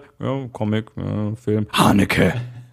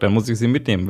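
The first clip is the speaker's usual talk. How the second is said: ordinary talk, nothing marked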